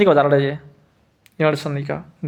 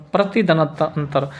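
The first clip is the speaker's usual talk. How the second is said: usual speaking voice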